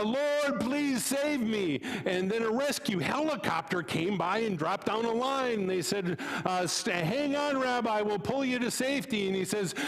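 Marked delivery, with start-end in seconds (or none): 0.0-1.8 high, slow plea for rescue; pleading rabbi. Acted. 6.7-9.3 higher, friendly call from the truck; eager neighbor. Acted. Helicopter crew calls.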